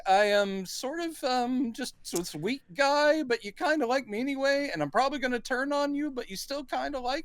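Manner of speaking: high-pitched weaselly character voice; lovable weasel. Acted. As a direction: play a weirdly lovable weasel, pitched well up. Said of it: "the kind of weirdly lovable weasel".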